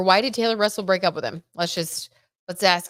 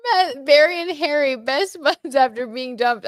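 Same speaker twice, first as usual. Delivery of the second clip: higher and louder, chuckling, slow on the second half; amused; 7 semitones higher, 5 dB louder than usual. Real, not acted. Host reading the Barry and Harry joke to viewers, loud and amused. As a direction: hold back a laugh while reading it out.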